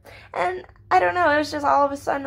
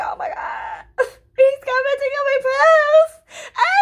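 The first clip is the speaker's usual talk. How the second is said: high, loud squealing laugh; giddy shriek over a crush's comment; excited squeal; 4 dB louder than usual. Retold or imitated. Squealing over cute boy's comment.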